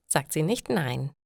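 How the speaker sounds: ordinary talk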